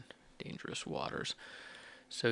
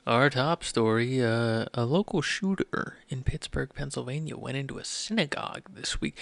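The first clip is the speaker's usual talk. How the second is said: mock public-radio newsreader, louder and measured; NPR voice; 9 dB louder than usual. Podcaster putting on a radio voice for the top story, NPR voice. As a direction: read the headline out loud in an NPR voice. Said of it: "that N P R voice".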